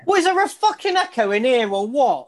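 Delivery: mock outrage at the repeat; exasperated. Real, not acted.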